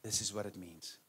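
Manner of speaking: very soft, low quoted reply; quiet voice of God. Retold or imitated. God quietly giving the answer months later, hushed and low. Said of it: "God's like".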